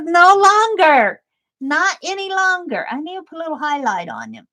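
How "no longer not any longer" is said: snowman's insistence. Pretend. Snowman ornament refusing a longer nose, playful high voice. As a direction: have him firmly refuse a longer nose. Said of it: "he says".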